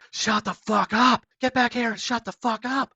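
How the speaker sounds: high, drawn-out mock yell; imitated top-of-voice yelling. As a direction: yell it like the raging stranger, held back a little. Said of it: "Just, like, yelling out top of his voice".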